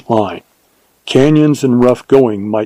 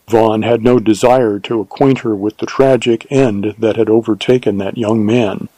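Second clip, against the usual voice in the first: ordinary delivery, nothing marked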